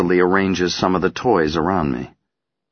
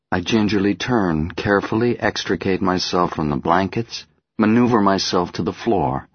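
ordinary delivery, nothing marked

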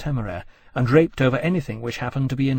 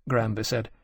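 ordinary delivery, nothing marked

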